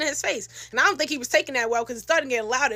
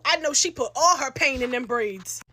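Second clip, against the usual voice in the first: ordinary talk, nothing marked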